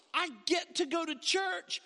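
bright, eager "I get to go to church" with lifted pitch; eager churchgoer. Acted. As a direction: sound glad to be going. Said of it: "people who woke up and said".

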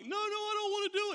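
high, fast protesting whine; pleading to get out of it; whiny Moses. Retold or imitated. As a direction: Moses whining to God, high and quick. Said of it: "And Moses is like".

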